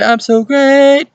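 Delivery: very high, loud, drawn-out mock squawk; exaggerated beginner voice, high and stretched; young beginner performer. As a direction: imitate an untrained youngster, loud and piercingly high. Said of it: "whenever they're young".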